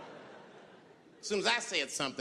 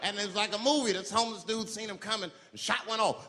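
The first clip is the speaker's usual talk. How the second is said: normal talking voice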